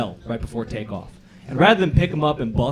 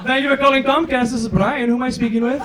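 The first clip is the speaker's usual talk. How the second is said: a bright, higher customer-service voice; call center agent; 9 semitones higher, 3 dB louder than usual. Retold or imitated. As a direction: answer like a chipper agent.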